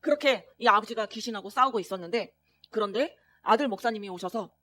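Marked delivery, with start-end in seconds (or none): none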